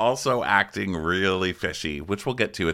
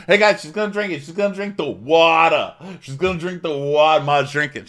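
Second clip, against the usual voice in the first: much higher and louder, gleeful; mock prank shouting; 8 semitones higher, 7 dB louder than usual. Prankster announcing to the guys, high and loud. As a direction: crow to the room about the trick. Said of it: "Joe's like".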